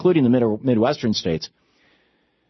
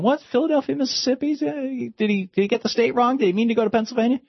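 much higher, fast, puzzled mock voice for "Philadelphia, Mississippi?"; 13 semitones higher than usual. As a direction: play a naive bystander baffled by the place name.

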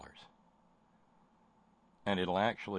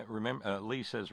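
normal talking voice

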